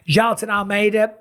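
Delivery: normal talking voice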